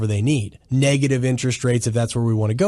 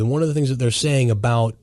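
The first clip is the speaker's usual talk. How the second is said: ordinary talk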